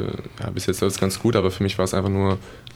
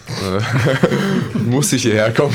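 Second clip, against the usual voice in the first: laughing through the words, louder; bursting into a laugh as he says it; real amused laugh on the punchline; 3 semitones higher, 5 dB louder, much slower than usual. Real, not acted. Joking about teammate's arrival.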